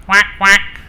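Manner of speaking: short high-pitched duck quacks. Acted. The couple hear a duck in the forest, quacking. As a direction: imitate a duck quacking in pairs. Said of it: "they heard the sound".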